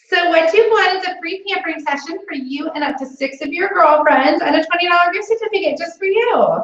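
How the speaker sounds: high, bright, eager phone voice; bubbly sales call. Shown as a demonstration. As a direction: sell it with big cheerful enthusiasm. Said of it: "I wanna hear you again".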